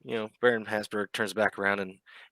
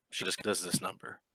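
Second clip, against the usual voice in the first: ordinary talk, nothing marked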